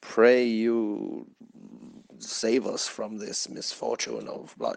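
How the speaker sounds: a hint of a theatrical medieval courtier begging his lord; mock old English court speech. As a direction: plead like a knight in a Shakespeare play. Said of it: "talking like that".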